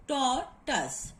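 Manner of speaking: TORTOISE slowly split into tor and tus; syllable by syllable. Shown as a demonstration. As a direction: pause between the halves of "tortoise". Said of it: "Tor is this syllable and the next".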